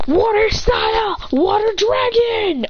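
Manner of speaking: high, drawn-out anime battle shout; slow, high-pitched battle cry; shouted attack call. Acted. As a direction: call out your special move. Calling out a jutsu attack.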